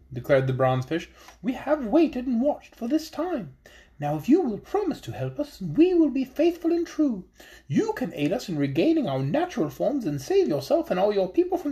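high, small voice of a wise little fish; high fairy-tale fish voice, earnest; magical fish voice. Acted. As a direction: speak up as a tiny enchanted fish, solemn and hopeful. Fish characters speak.

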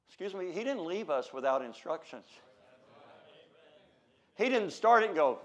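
usual speaking voice

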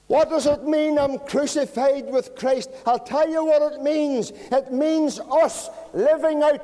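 loud, high, ringing preacher's cry; full-force preaching. Acted. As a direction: hammer each question at the congregation.

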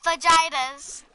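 fajitas said with a hard English J, "fa-JY-tas"; mom's mispronunciation. Retold or imitated. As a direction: say "Fajitas" as "fuh-JAI-duhz". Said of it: "my mom calls fajitas fajitas".

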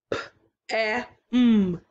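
each sound split apart; segmented sounds. Shown as a demonstration. Teacher segmenting for the class, sound by sound. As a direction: stretch out P, A, M one by one. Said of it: "The next word has three sounds".

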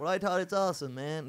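imitating a Californian surfer, protesting; put-on American accent. Returned emigrant defending their speech to Irish people, mock American accent. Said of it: "Why do you sound different?".

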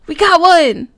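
loud, high triumphant shout; loud high victory yell; excited. Real, not acted. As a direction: cheer the catch. Catching a villager.

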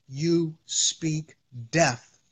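slow and low, words set apart; hushed, deliberate pronouncement; grave slow warning. Real, not acted. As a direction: let "you speak death" fall slowly and softly. Solemn warning, then repeated.

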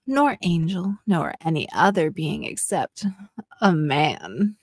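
normal talking voice

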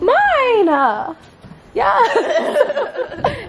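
high gleeful shout; excited. Real, not acted. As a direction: squeal with delight. Child grabs her cookie.

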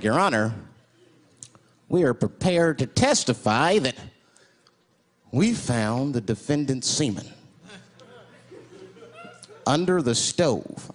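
low, slow, formal testimony; forensics witness. Acted. Forensics expert testifying to the judge, solemn. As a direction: testify gravely, drawing the words out. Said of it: "Forensics was like".

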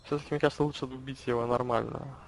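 ordinary talk, nothing marked

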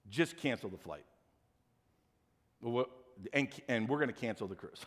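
low, soft, deflated delivery; resigned. Retold or imitated. Husband cancelling the flight as wife listens, defeated. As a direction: give up quietly, voice dropped.

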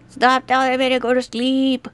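ordinary talk

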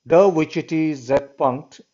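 slow, careful reading of the German words. Shown as a demonstration. Teacher reading the German note to the class, slow and careful. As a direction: sound out each German word slowly. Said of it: "I'll try my best to read the German text".

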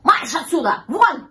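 loud, high angry order; angry cat ordering intruders away; cat yelling. A narrator's reading. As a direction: drive them out with a shout. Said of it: "закричал кот".

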